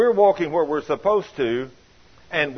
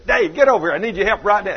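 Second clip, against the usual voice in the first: louder, a bossy call across the yard; calling out to Dave; 4 semitones higher, 7 dB louder, faster than usual. Retold or imitated. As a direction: holler for a helper to come over. Calling Dave over to help.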